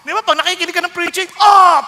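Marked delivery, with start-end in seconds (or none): 1.4-1.8 very loud, high exclamation; imitated stung "oh!". Preacher acts a listener hearing preaching, loud mock cry of pain. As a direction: cry out as if slapped, hammed-up.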